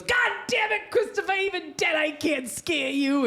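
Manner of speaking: very high shouted mom impression; exasperated mother. Dead mother scolding son in dream, exasperated shriek.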